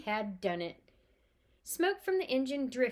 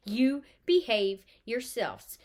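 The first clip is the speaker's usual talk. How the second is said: slow, loud, emphatic warning; stern warning; 8 dB louder, much slower than usual. Acted. Mother warning her mischievous twins, slow stern emphasis. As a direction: lay down the law word by word.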